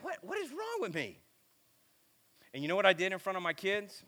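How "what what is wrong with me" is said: much higher, softer strained voice; mock pained cry of self-disbelief; exasperated self-scolding. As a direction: whine it at yourself in dismay. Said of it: "and I'm like".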